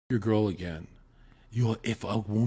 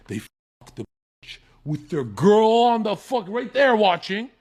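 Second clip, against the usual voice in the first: normal talking voice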